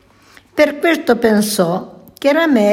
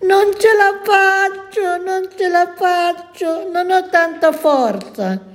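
very high, strained yell of effort; straining cry; 9 semitones higher than usual. A narrator's reading. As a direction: shout as if the rope is slipping away. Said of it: "il coniglietto si mise a gridare".

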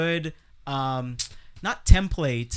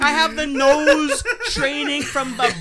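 high, loud whining dog voice; talking animal; 9 semitones higher, 5 dB louder than usual. Pretend. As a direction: whine it like a hurt dog, half crying. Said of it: "the dog looks back up at him and goes".

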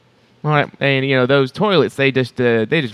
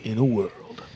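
trailer voice; 4 semitones lower, 4 dB quieter than usual. Pretend. Movie trailer narrator parody.

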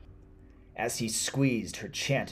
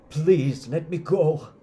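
very loud, high-pitched begging; desperate cry; 9 semitones higher, 12 dB louder, slower than usual. Acted. Tortured man begging the witch for freedom, crying out. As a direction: shout a desperate plea for release. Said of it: "he cried".

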